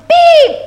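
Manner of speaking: high, loud imitated horn beep; a squeaky-high toot of a little horn; truck beep. A narrator's reading. Little truck greeting a toad, high cheerful beep. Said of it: "said Blue".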